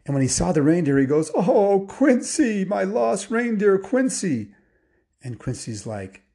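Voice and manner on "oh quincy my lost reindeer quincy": high, loud, emotional cry of delight; overjoyed Santa. Acted. Santa finds his lost reindeer, overjoyed and nearly crying. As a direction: greet a long-lost friend, voice swelling high. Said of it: "so excited he almost cried".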